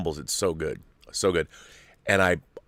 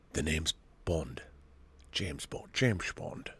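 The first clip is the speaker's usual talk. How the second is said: low, soft mock Scottish accent; badly faked Scottish spy accent; Sean Connery; 5 semitones lower, 13 dB quieter, slower than usual. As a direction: attempt Bond's famous introduction in a hushed Scottish accent. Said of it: "I don't sound like Sean Connery".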